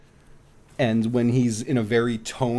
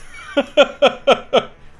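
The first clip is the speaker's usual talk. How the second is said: loud high burst of laughter; laughing hard at the punchline; 13 semitones higher, 6 dB louder than usual. Real, not acted. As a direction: cackle at the memory. Laughing at own story.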